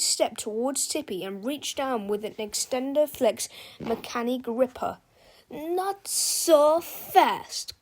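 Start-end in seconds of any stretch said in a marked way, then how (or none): 5.5-7.7 slow, loud warning cry. Acted. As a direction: stop him with a shout. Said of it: "cried Big Tippy".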